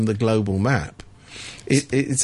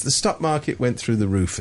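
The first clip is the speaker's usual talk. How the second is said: usual speaking voice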